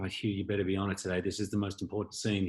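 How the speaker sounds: low-volume, hurried imitation of pressure; imagined pressuring director. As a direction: mutter it quickly, as a mock nagging director. Imagined pressuring director, muttered fast.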